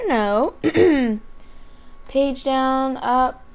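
ordinary delivery

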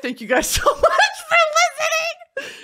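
thanks breaking up into laughter; giggly, half-shouted fake goodbye; laughing sign-off. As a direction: laugh through a mock sign-off.

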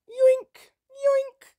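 very high cartoon squeal; snatch it with a silly shriek; high-pitched goofy yoink; squeaky yoink. Real, not acted. Grabbing souls playfully.

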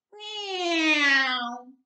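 high, bright little cat meow; a small bear playing cat, meowing high; kitten meow. A narrator's reading. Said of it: "went Baby Bear".

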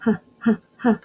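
a breathy h sound said three times; isolated letter sound. Shown as a demonstration. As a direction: push out a puff of air: h, h, h. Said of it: "How about hat?".

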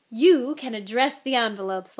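loud, grandly generous offer; as though a treat. A narrator's reading. Lockhart handing Harry envelopes, as though a huge treat. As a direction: offer a chore like a gift, mildly grand. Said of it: "as though this was a huge treat".

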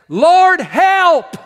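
desperate loud cry for help. Acted. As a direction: cry out to the Lord, pleading. Sailors crying out to God.

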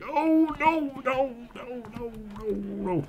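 high, panicky no's fading down; scared. Real, not acted. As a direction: start in a high fright then calm. Monster appears suddenly.

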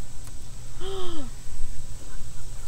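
sharp high breathy gasp of alarm; startled gasp. Real, not acted. Woman realizing the dogs are gone, gasping. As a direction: catch your breath in surprise.

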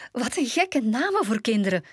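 high giggle into amused words; laugh, then say it amused and high-pitched; laughing exclamation. A narrator's reading. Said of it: "riep Sneeuwwitje lachend".

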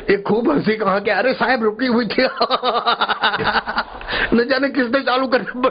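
high voice breaking into giggles; laughing while talking. Acted. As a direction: officials who can't stop laughing. Officials questioning each other, all laughing.

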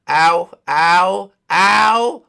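loud ow, ow, ow; mock pained ows. Character hit, yelping loud ows. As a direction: yelp as if hurt.

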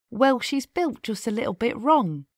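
ordinary delivery

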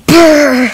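shivering brr. Real, not acted. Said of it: "That was crazy".

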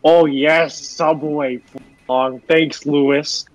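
usual speaking voice

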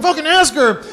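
high, insistent mock urging; urgent prodding. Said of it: "kicking her under the table going".

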